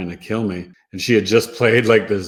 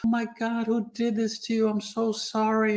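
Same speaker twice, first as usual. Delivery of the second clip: much higher, gentle voice of a dismayed nurse; sweet angel nurse; 13 semitones higher than usual. Retold or imitated. Nurse finding him restrained, high sweet alarm. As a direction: play the kind nurse who finds him tied down, aghast and sorry. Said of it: "she was like a sweet angel to me".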